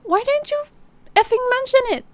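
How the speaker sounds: very high, strained angry cry; shrill-pitched frustrated outburst; furious accusation. Acted. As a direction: demand it in outrage.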